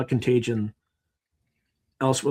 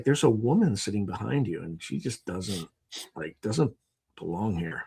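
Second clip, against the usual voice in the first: hushed warning; 5 semitones lower, 5 dB quieter than usual. Retold or imitated. Friend warning him quietly about the woman behind. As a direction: lean in and keep it low.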